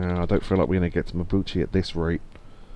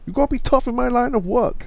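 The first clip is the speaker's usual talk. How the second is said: high-pitched mock Chinese accent. Game character on their tough line of work, put-on Chinese accent. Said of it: "I could do a Chinese accent".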